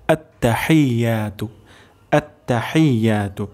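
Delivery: আত্তাহিয়াতু slowly, short tta, "hai-ya"; correct pronunciation. Shown as a demonstration. Teacher repeats the correct form for learners, slow and careful. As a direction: draw out at-ta-hai-yaa-tu. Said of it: "সহিহ উচ্চারণটি হবে".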